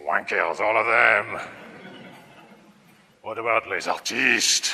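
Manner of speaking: put-on slow, heavy French accent; outrageous French accent. Cartoon artiste grumbling about cartoonists, outrageous French accent. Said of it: "He speaks with an outrageous French accent".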